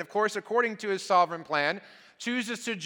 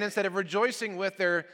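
ordinary talk, nothing marked

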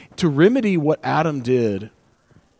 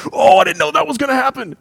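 high, loud mock gasp of surprise; amazed viewer; 11 semitones higher, 5 dB louder than usual. Preacher voices fans watching Lost, hammed-up amazement. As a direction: play up the shock of a twist. Acted viewer reacting to plot twist.

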